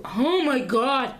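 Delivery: half-done gruff man voice when mad. Real, not acted. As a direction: drop into a mock man voice, halfway. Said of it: "I put on my man voice".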